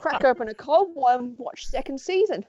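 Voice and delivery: a hammed-up Australian bloke, pitch jumping up; badly faked Australian, going for it; bad Australian accent. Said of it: "My accents are terrible".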